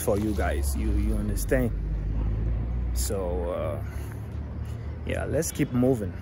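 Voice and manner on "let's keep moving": ordinary talk, nothing marked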